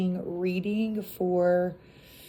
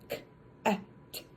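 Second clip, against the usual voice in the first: letters sounded out one by one; choppy sounding out; 7 dB quieter, much faster than usual. Shown as a demonstration. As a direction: sound it out like a struggling reader. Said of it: "like that".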